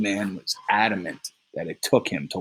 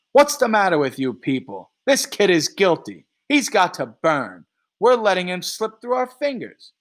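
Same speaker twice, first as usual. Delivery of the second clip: angry rant; 8 semitones higher, 3 dB louder than usual. Acted. Three rants at the jurors.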